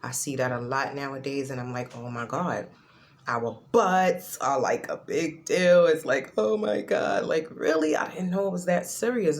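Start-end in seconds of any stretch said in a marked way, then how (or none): 2.0-9.4 high, loud fake astonishment; mock shock. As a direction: play up the gasping disbelief. Mocking people's shock about butts.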